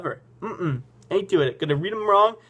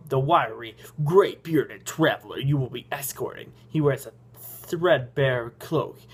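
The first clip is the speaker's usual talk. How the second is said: ordinary talk, nothing marked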